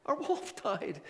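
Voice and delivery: high, mock-mournful wail; hammed-up grief with a touch of laughter; sad townspeople. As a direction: play heartbroken mourners in a high voice. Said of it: "people were really sad".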